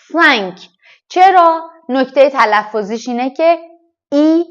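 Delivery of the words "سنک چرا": ordinary talk, nothing marked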